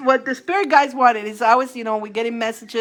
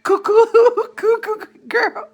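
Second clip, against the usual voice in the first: giggling; 11 semitones higher, 4 dB louder than usual. Real, not acted. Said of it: "I'm laughing around the house".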